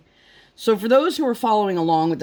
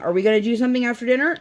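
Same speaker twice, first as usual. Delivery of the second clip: higher, rushed mimicked kid question; imitated pestering daughter; 6 semitones higher, much faster than usual. Mom imitating daughter asking about after dinner, pestering. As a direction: hurry it out like an insistent child. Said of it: "now our daughter, seven thousand times a day".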